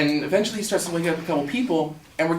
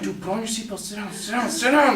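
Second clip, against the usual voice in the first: high, hushed urgency rising to full voice; acted hushed pleading that builds; trying to calm someone; 5 semitones higher than usual. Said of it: "trying to calm him down".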